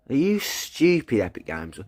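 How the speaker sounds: high, incredulous jab at the developers; exasperated outburst. Real, not acted. Gamer fuming at Epic Games over missing banner, incredulous. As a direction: scoff at Epic Games in disbelief.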